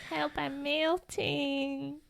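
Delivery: slow, mock-helpless wail; playful melting cry. Sister joking about the rain, mock-helpless wail. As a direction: draw it out like someone melting away. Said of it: "As Mom would say".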